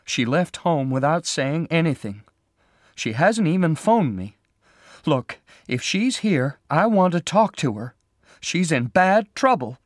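upset, high and loud; distressed, voice pitched well up; shaking voice. A narrator's reading. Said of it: "her voice beginning to shake".

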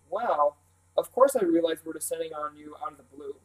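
ordinary delivery, nothing marked

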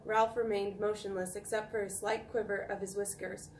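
ordinary delivery, nothing marked

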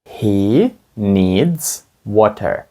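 very slow, word by word; slow teacher demonstration; slow model sentence. Shown as a demonstration. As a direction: spell it out slowly for the class. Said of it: "Скажіть, йому потрібна вода".

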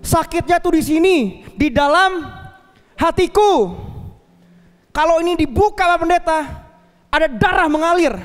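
jilted woman complaining to her pastor, mock angry; upset woman. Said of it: "Dia marah".

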